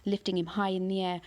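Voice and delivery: ordinary delivery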